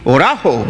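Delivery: Orajo with the middle syllable ra stressed; Orajo, accent on the second syllable. Shown as a demonstration. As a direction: lean on ra in Orajo. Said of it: "because that's where the accent is".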